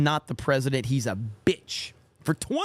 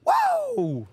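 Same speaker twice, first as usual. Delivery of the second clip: strained, much higher hoarse Ric Flair woo. Retold or imitated. Streamer attempts a Ric Flair woo, hoarse and cracking. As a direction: squeeze out a cracking wrestler's woo. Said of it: "That's all I got".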